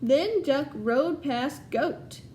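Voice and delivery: lively storybook read-aloud to a class; reading to children. Acted. Teacher reading Duck on a Bike to her class, storytelling voice. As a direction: tell it brightly so the kids follow along.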